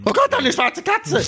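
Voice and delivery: mock shriek, very high, overdriving mic; clipping distorted mic. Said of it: "Ihr sollt nicht so schreien, es zerrt". (gespielter Schrei, übersteuert verzerrt)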